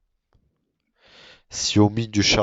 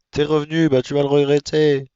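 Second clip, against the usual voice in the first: mock threat; 7 semitones higher, 5 dB louder than usual. Threatening the grey sheep in game.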